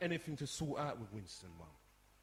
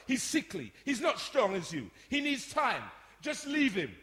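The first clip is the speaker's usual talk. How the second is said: loud, raised, resentful rant; angry outburst; 6 semitones higher, 6 dB louder than usual. Acted. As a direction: let years of resentment out at mum. Rant to mother about favoured brother.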